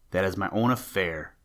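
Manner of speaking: plain reading voice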